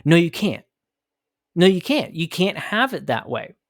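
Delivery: ordinary delivery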